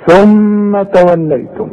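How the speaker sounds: ثم with its م humming for two beats; nasal ghunna. Shown as a demonstration. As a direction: hold the ghunna on ثم. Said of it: "شوف".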